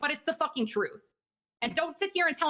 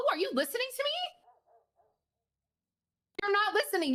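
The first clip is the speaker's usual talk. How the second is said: much higher pitch, exasperated demand; 9 semitones higher, faster than usual. Acted. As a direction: make him hear you. Exasperated at colleague.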